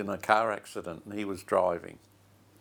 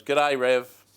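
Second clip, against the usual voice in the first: louder, put-on voice of a troubled man; casual greeting, mock-voiced; greeting the pastor; 3 semitones higher, 6 dB louder than usual. He looked at me and he goes.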